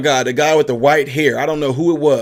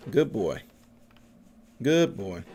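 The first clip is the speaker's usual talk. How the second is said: soft praise; 4 semitones lower, 7 dB quieter, slower than usual. Real, not acted. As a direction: praise the dog softly. Praising dog softly.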